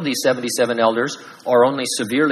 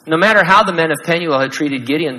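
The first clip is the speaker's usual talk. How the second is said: ordinary talk, nothing marked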